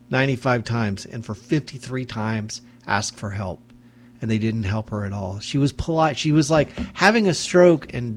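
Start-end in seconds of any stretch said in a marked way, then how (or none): none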